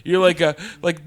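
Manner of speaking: ordinary talk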